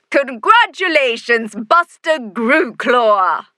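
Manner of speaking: big, slow ceremonial shout into microphone. A narrator's reading. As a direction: proclaim it to the crowd. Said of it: "shouts Mayor Red Wolf".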